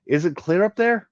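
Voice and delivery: high, loud call up the shaft. Acted. Ward calls up the ladder to Tarek, high and loud. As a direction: shout up to the roof.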